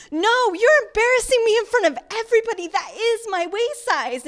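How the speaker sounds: high put-on voice, mortified and defiant; woman embarrassed in front of everybody, high pleading. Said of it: "she's like".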